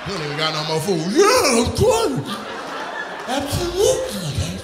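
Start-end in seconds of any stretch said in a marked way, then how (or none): 1.1-4.6 high, slow mouth-full mimic; mocking his voice. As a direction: mimic his odd voice, drawn out. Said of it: "you actually talk like that".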